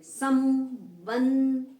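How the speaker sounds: संबंध loud and extremely slow, both nasals hummed; nasal syllables. Shown as a demonstration. Teacher showing the nose sounds, संबंध loudly stretched and nasal. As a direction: hold "sammm" and "bannn" in संबंध through the nose. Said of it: "नासिका से बोले जा रहे हैं".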